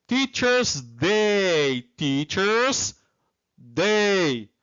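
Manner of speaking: drawn-out, careful teacher's model; slow, stretched-out English answer; slow model pronunciation. Shown as a demonstration. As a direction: say it slowly for students to copy.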